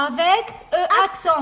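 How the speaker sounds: "Avec E accent" stretched very slowly, loud and high; slow each syllable right down for the child; mother repeating "Avec E accent" extra slowly to her son. Shown as a demonstration. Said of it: "mother repeats it slower".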